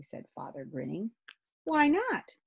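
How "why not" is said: put-on higher girl's question. Kirsty asks Father.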